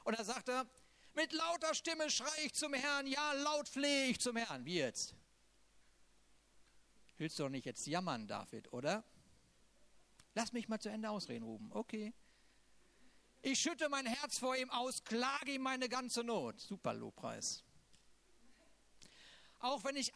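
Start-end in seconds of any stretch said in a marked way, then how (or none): 1.1-4.6 higher, fervent scripture reading; impassioned reading of a lament; psalm reading. Acted. Reads Psalm 142 aloud. 13.4-16.6 higher, fervent scripture reading; impassioned reading of a lament; psalm reading. Acted. Reads Psalm 142 aloud.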